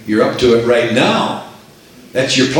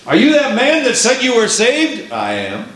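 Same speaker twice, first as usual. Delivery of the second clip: sceptical challenger; 9 semitones higher than usual. Acted. Preacher voices a challenger.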